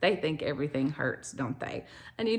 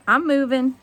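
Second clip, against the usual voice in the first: normal talking voice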